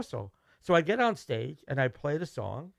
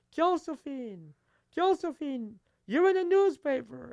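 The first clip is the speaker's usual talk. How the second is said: much higher, slow, drawn-out calling of the name; excited relative calling; 11 semitones higher, slower than usual. Retold or imitated. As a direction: call out the mom's name down the phone, thrilled. Relative phones mom with news.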